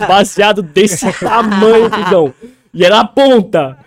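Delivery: loud, slow, laughing through the words; big laughing exclamation to a friend; laughing loud brag. Real, not acted. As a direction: crack up as you boast about it. Laughing brag about huge joint.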